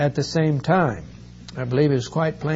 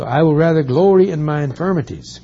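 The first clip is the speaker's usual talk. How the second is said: louder, ringing scripture proclamation; proclaiming; 3 semitones higher, 8 dB louder, faster than usual. Acted. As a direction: declare it boldly to the congregation.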